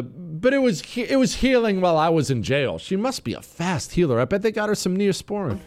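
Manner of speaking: high-pitched, sceptical mimicry; mocking imitation. Host mocking a clip of an arrested woman, mocking. Mocking the guest's healing claim.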